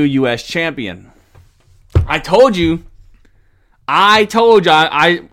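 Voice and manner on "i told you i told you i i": loud. Real, not acted. Gloating about prediction.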